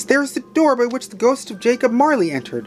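high-pitched giddy excitement; crying out. Acted. Scrooge seeing proof the spirits came, crying out joyfully. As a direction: marvel aloud, building to laughter. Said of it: "cried Scrooge".

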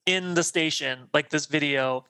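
ordinary delivery

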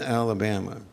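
ordinary delivery

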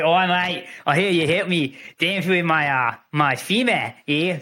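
put-on second go at a shaky Aussie accent; hesitant mock-Australian voice; bad Australian accent. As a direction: imitate an Australian, feeling for the words. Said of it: "I can't do the accent".

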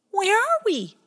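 lost and scared; frightened. Acted. Pie Jam asks in the dark swamp, high scared.

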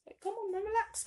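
high-pitched exasperated plea to relax; mock-annoyed, pitched well up. Venting at friends who make life harder, annoyed. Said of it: "I was kind of annoyed".

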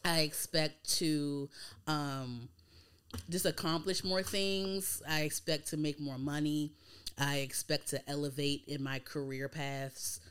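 normal talking voice

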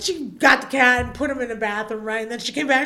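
ordinary delivery

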